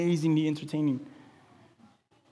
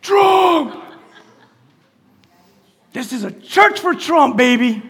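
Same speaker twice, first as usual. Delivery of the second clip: shout; 9 semitones higher, 10 dB louder, slower than usual. Acted. Playful shout at congregation.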